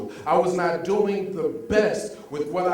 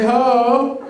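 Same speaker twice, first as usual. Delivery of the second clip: loud call, pitched up; crowd call; 5 semitones higher, 9 dB louder than usual. Shown as a demonstration. Speaker calling out to the audience, loudly. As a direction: get the crowd to shout back. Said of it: "Y'all see how that was louder".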